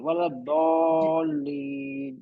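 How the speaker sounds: "ولا الضالین" drawn out as wala dawlleen; heavy ḍād recitation. Shown as a demonstration. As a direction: stretch "ولا الضالین" slowly with a dark aw. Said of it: "heavy میں او کی آواز آئے گی".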